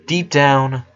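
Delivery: ordinary delivery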